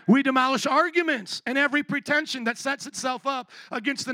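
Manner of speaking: loud, driving sermon delivery; full-force preaching. Acted. Preacher hammering the point home to the congregation. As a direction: preach it hard to the crowd.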